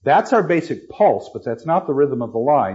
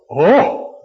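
louder, a gasp of breath; breathless oh; 4 semitones higher, 6 dB louder than usual. Acted. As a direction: let the "Oh" burst out on an exhale. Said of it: "exhalation of breath".

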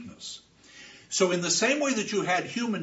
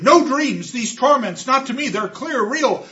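higher and louder, still in terror; desperate insistence; 8 semitones higher, 9 dB louder than usual. Acted. Orestes tells the chorus the hounds are real, desperate and loud. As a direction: insist it is real against disbelief.

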